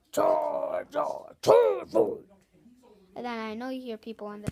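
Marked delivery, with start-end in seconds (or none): 0.1-2.2 a slow, loud dragon call rising higher; nice Charizard voice. Shown as a demonstration. Kid doing his nice Charizard voice for viewers, loud and drawn out. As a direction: call out the name like a friendly dragon. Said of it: "this is my Charizard nice voice".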